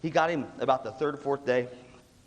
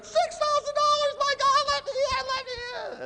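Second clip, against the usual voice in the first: very high, frantic mock screech; high-pitched hammed-up clamour of desperate kids; frantic kids outside; 3 dB louder than usual. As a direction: beg to be let in, squealing. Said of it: "These kids are on the outside going".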